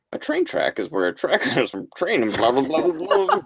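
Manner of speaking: lightly voiced know-it-all kid. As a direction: rattle off the fact like a know-it-all. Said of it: "that was a perfect impression".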